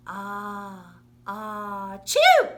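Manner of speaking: a building ah-ah then explosive loud chew; acted sneeze. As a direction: wind up the sneeze and blast it.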